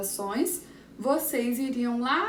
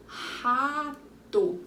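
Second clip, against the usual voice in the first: "rato" slowly drawn out; long, measured "rato"; stretched-out syllables; 3 semitones higher than usual. Shown as a demonstration. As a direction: linger on both syllables of "rato". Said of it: "Duas sílabas".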